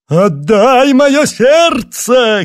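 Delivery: drawn-out, high ghoulish scream; loud, high-pitched phantom wail; spooky shout. A narrator's reading. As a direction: scream it slowly like a ghoul demanding its due. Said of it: "крикнула фигура".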